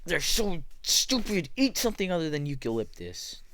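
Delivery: high, loud slowed outburst; mock exasperation. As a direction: scold the koalas like a fed-up kid. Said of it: "stupid animal".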